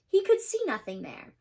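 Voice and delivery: ordinary delivery, nothing marked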